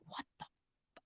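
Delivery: breathy whispered disbelief; half-whispered bewildered mutter; stunned whisper. Pretend. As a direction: whisper it in shock. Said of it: "You'd be like".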